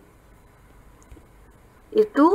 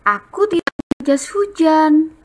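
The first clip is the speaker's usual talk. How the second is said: higher storybook voice as Edo; reading dialogue; 7 semitones higher than usual. Shown as a demonstration. Teacher reading Edo's answer to class. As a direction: admit it plainly. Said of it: "Bacanya dengan intonasi yang tepat".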